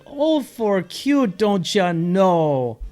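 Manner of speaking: very high, very slow Minnesota coo; mother sees a baby, coos in Minnesota accent; gushing over a baby. Shown as a demonstration. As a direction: melt over a newborn puppy in Minnesota accent. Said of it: "We see a newborn baby or a puppy".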